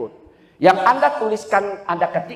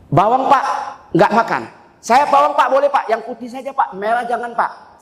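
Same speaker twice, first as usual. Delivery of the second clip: fussy tourist; 6 semitones higher than usual. Acted. Voices picky tour member.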